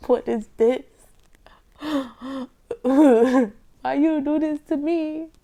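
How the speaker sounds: loud, slow mock-wailing complaint with a sob; mock crying. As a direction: ham up a dramatic whine over the bad tea. Tasting grandma's nasty tea.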